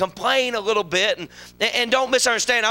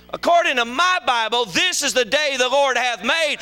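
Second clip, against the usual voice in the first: ordinary delivery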